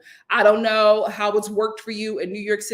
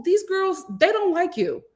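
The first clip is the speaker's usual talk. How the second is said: ordinary talk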